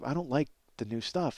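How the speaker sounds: ordinary talk, nothing marked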